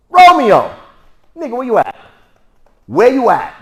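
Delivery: very high, loud yell; hometown shout. Retold or imitated. Teacher mimicking hometown Juliet, loud high yell. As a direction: holler down the street for him. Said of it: "how would they say that where I was born".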